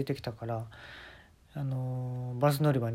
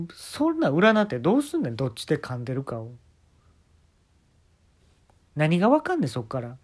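indignant rant; 2 semitones higher than usual. Real, not acted.

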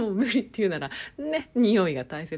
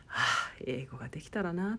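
sighed wish, soft and lower; wistful sigh; 5 semitones lower, 5 dB quieter than usual. Retold or imitated. Speaker recalling emails with people overseas, wistful sigh. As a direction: breathe out a small, longing sigh into the words.

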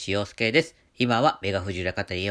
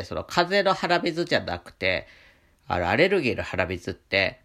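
ordinary talk

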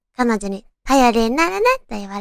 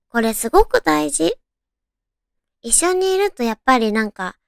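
ordinary delivery

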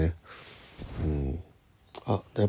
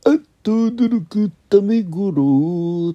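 high, loud, drawn-out comic catchphrase; 12 semitones higher, 9 dB louder, slower than usual. Retold or imitated. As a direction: belt it like a TV comic. Quoting old catchphrase.

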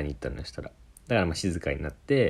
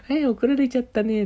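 much higher, lightly voiced aunt talking to a dog; mock aunt cooing; 4 dB louder than usual. Aunt cooing at a scolded dog, high mock baby talk. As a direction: coo it like a doting aunt who feels no shame.

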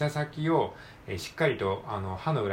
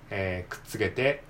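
ordinary talk

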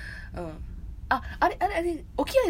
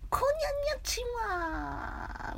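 much higher, slowed cutesy anime greeting; Hisakawa Aya impression; 14 semitones higher, slower than usual. Host's retry at Hisakawa Aya's greeting, far higher. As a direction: squeak the greeting up high, a second try. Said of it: "無理ですね".

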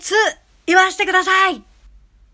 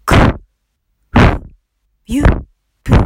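popping breath bursts on the mic; mic popping demo; 2 semitones higher, 13 dB louder, much slower than usual. Shown as a demonstration. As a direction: blast each syllable straight into the mic. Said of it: "吹く例として".

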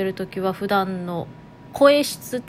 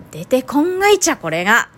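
Toyama dialect, jumped higher and louder; Toyama dialect burst; 4 semitones higher, 6 dB louder than usual. Real, not acted. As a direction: blurt it in thick Toyama dialect. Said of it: "イントネーションは富山弁混じってる". (富山弁で高く強く)

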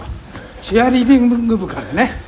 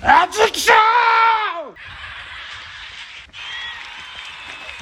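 calling out; 12 semitones higher than usual. Pretend. Calling out the host's name.

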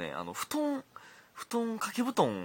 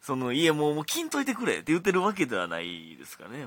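raised, insistent backpedalling; desperate excuse; 5 semitones higher, 5 dB louder than usual. Real, not acted. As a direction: hurry to clear up the misunderstanding. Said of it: "必死の弁解ですけど". (必死の言い訳口調)

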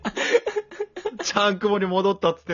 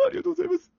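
high laughing mock-sob thanks; mocking the fake cry; 12 semitones higher, much faster than usual. Mocking partner's failed crying thank-you, laughing. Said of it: "ただなんか被っただけのやつやん".

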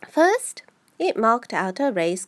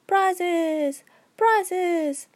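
1 confused calling out; 8 semitones higher than usual. A narrator's reading. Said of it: "calling out in a confused way".

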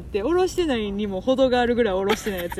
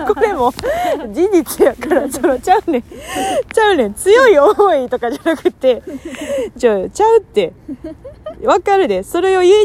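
excited protest; 6 semitones higher, 7 dB louder than usual. Real, not acted. Host protests listener's wording.

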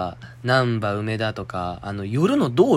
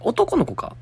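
surprised; slower than usual. Real, not acted. Sudden doubt.

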